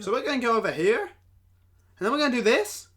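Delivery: loud, high mock line reading; one-tone delivery. Co-host mocking the actress's line delivery, loud monotone. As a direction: parody an actress stuck on one note, loudly. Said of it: "like, one tone".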